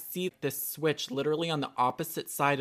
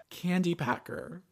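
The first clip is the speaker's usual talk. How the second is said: slow, higher-pitched retold job title; drawn-out repeat; 5 semitones higher than usual. Friend repeating the job listing, slowly drawn out. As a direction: stretch it out with a lift.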